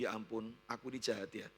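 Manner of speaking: low, subdued self-reproach; remorseful pity. Retold or imitated. Husband pities sick wife and blames himself, low remorseful voice. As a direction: confess it softly, sinking at the end. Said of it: "dari rasa kasihan itu".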